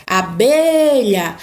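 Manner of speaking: abelha with BE drawn out slowly; calling out. Shown as a demonstration. Teacher giving examples of tonic syllables, calling out. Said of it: "fica por mais tempo na minha boca".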